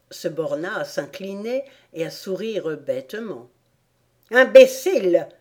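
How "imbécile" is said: loud, high impatient outburst; impatient cry. A narrator's reading. Queen scolds the valet of hearts, impatient cry. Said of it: "cria la reine impatientée".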